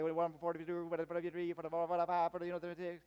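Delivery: rapid mumbled Southern auctioneer imitation; auctioneer patter. Shown as a demonstration. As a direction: rattle it off unintelligibly like an auctioneer. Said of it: "they're doing the auctioneer kind of thing".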